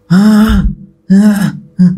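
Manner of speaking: mock cries of someone yelling in sleep; sleep-talker's yell. Imitating a neighbour crying out at night, sleep-talker's yell. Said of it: "Ya kayak orang lindur lah".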